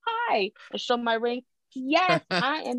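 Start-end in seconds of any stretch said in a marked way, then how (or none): 0.1-0.5 high, airy "Oh, hi"; lilting society-lady hello; mock fancy greeting. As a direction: greet like a flattered bride. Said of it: "to be like".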